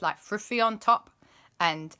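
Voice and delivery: usual speaking voice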